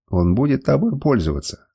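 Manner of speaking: ordinary delivery, nothing marked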